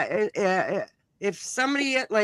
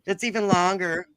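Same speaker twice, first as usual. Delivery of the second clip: ordinary talk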